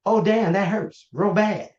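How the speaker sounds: slow, hammed-up cry of pain; acted pained complaint. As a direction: play someone suddenly feeling the hurt. Acts out patient coming off drugs.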